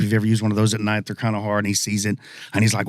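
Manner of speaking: ordinary delivery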